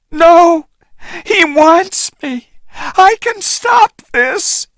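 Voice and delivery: very high pleading shout. A narrator's reading. Anne refusing the boat, pleading shout. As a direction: insist she can stop it. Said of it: "Anne shouted".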